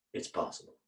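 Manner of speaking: hushed, breathless whisper; whispered aside. Real, not acted. Storyteller confides to the audience, whispered. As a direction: let it hang as a secret.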